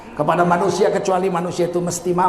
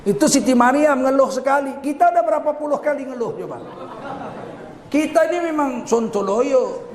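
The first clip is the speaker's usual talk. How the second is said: normal talking voice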